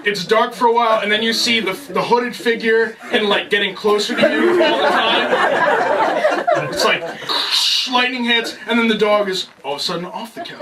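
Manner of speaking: laughing. Real, not acted. Horror movie build-up, laughing.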